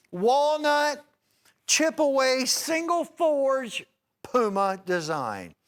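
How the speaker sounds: high, loud shopping-channel pitch; pitchman sales pitch. Real, not acted. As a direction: sell it big and bright to the viewers. Presents next knife.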